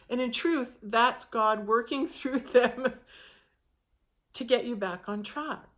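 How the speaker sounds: normal talking voice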